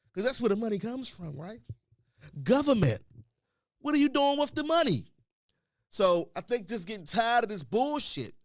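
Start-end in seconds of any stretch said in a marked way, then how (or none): 2.4-5.1 loud, high, fed-up call out; exasperated rant. Real, not acted. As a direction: shout your frustration at the government. Ranting at government.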